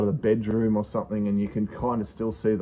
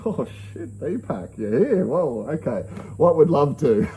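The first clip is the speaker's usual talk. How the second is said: acted amazement; 4 semitones higher than usual. Amazed at guru appearing to him.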